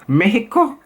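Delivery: put-on high, rising Mexican-accented "Mexico". Vlogger trying city impressions, high Mexican accent. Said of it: "did they pronounce Mexico".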